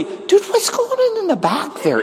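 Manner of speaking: very high-pitched mock bewilderment; comic high-voiced complaint; baffled complaint. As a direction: squeak it out as a puzzled member. Acts out complaining church member.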